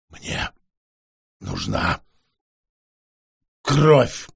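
low, hoarse croak of a vampire; hoarse rasp. Acted. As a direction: rasp it out, throat dry. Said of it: "прохрипел вампир".

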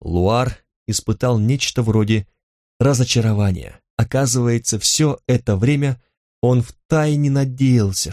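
usual speaking voice

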